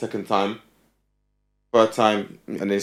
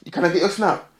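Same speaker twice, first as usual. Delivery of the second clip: mock chat-up voice; 8 semitones higher, faster than usual. Mocking basic chat-up.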